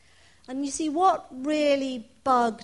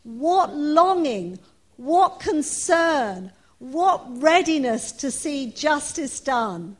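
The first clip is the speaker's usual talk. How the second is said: loud, slow, solemn Bible reading; scripture reading; 7 dB louder than usual. Acted. Preacher reading Paul's letter aloud to the congregation, loud and slow. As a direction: proclaim the letter to the church, slowly.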